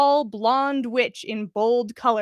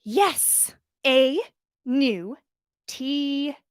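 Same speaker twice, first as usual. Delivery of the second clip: slow and deliberate; 3 semitones higher, much slower than usual. Acted. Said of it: "spoke slowly this time".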